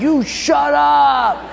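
high, loud, slow shout; mock shout. Tired runner yells back at his gloating friend, loud. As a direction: bark back in mock anger. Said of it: "I said".

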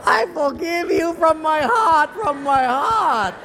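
slow, loud, high mock-tearful cry; gushing forgiveness. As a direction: declare it in drawn-out, hammed-up emotion. Owner suddenly forgives for money.

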